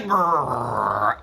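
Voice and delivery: low growled grrr like a hungry stomach; stomach growl imitation. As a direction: make your belly rumble with a growl. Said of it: "My stomach going".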